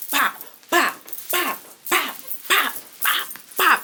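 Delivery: punch sounds. Retold or imitated. Vlogger mimics Ivory landing punches with mouth sounds. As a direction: tap out each hit.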